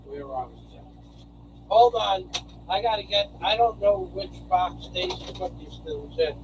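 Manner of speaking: far from the mic, muttering. Real, not acted. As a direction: talk while walking away from the mic. Walked away from the mic searching.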